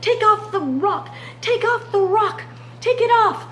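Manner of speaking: frantic king ordering the rock removed; urgent king. Acted. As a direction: hurry the guards off the rock. King rushes to the den.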